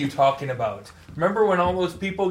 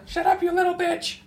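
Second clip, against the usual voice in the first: mock high shout. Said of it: "She's like".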